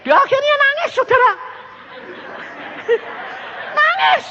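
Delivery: very high, incredulous exclamation; high squeal of storytelling excitement; exclaiming. Real, not acted. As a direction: tell it in high-pitched comic dismay. Exclaims over visitor bursting into tears.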